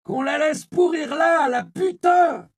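high, loud jeering shout, slowing at the end; angry crowd shout. A narrator's reading. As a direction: spit it out at the body. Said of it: "cria un autre". (un homme de la foule crie, aigu et fort)

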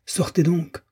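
high-pitched, understated angry order; mock furious cry. A narrator's reading. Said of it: "Une voix furieuse leur cria". (cri furieux atténué, voix plus aiguë)

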